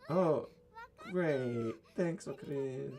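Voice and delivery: high, loud burst of joy; overjoyed, bubbly thanks; delighted cheer. Acted. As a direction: cheer your friend in a high voice. Mayuri cheers the good news.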